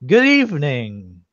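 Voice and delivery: hammed-up old man's formal greeting; old statue. Senile statue prompted by his servant, muddled proclamation. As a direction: announce it as a doddering old statue. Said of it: "the voices sound old".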